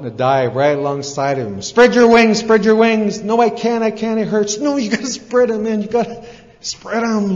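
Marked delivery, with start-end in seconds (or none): 1.6-3.2 high, loud acted yell; frantic owl coaching mid-dive; urgent shouted command. Owl shouts to falling eaglet. 3.2-4.6 high-pitched acted wail of refusal; frantic eaglet in free fall; panicked protest. Falling eaglet cries he can't. 4.6-7.3 high-pitched, pressing acted shout; frantic owl insisting; urgent insistence. Owl urges falling eaglet.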